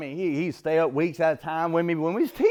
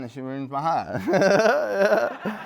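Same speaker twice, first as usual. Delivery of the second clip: laughing; much slower than usual. Real, not acted. Punchline, laughs at his story.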